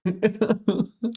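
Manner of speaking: high laughing burst; burst of laughter. Real, not acted. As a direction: let out a real laugh. Laughs at own joke.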